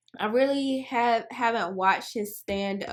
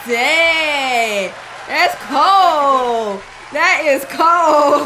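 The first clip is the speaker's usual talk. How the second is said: loud, stunned "oh dang, that's cold"; shocked reaction; 5 semitones higher, 12 dB louder, much slower than usual. Real, not acted. Reacting to the comedian's dark punchline, loudly shocked. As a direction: react like the joke hit hard.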